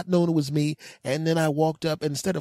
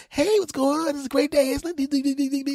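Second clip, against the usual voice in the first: very high mock-cheerful chirp; giddy greeting; 11 semitones higher, faster than usual. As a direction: squeal it, trailing into silly twitter. Greeting once he has shaved.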